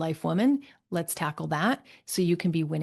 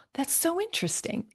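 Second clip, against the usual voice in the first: intrigued surprise; 10 semitones higher, 4 dB quieter than usual. Retold or imitated. Reacting to client's remark.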